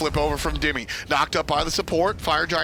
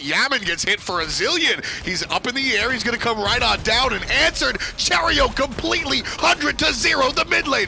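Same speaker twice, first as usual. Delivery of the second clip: building to high-pitched hype commentary; excited play-by-play; 7 semitones higher, 3 dB louder than usual. Real, not acted. Caster calling a sudden kill to viewers, building excitement. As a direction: call the kill with rising excitement.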